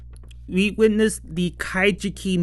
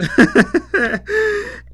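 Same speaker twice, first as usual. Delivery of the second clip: squeaky rising giggle; giggling at the absent mother; high giggle; 6 dB louder than usual. Real, not acted. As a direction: burst into a high little laugh. Laughing at missing Mama-san.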